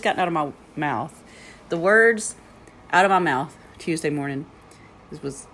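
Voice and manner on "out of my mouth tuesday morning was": ordinary delivery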